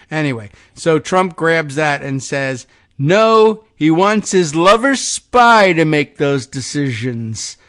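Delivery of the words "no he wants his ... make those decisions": slow, loud, higher mock insult; all-caps shout. Host reading Trump's attack post, mock loud shout. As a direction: yell out loser like a rally taunt. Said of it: "all caps".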